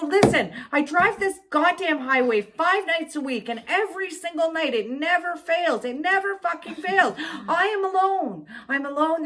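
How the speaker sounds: high-pitched, worked-up tirade; exasperated rant. Retold or imitated. Driver ranting at a cop about tailgaters, exasperated. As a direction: let it pour out, fed up.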